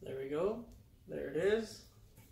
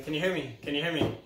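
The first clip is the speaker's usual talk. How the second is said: usual speaking voice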